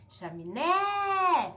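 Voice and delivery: chaminé slow, with NÉ long and high; stronger stressed syllable. Shown as a demonstration. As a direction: lift and stretch the final syllable of "chaminé". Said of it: "o acento é a mais forte".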